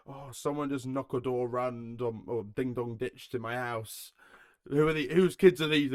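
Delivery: hammed-up local moaner winding herself up; complaining Karen. Imitating a neighbour griping on the Facebook page, rising to loud outrage. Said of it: "People just complaining".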